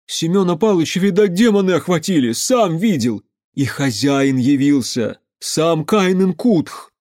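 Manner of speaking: high, agitated peasant account; agitated testimony. A narrator's reading. Pyotr tells the crowd about the demons, agitated. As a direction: proclaim it urgently to everyone.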